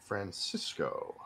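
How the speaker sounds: "Francisco" slow, soft and low; savoring the word. Pretend. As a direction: draw "Francisco" out quietly, relishing it. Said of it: "Such a fun word to say".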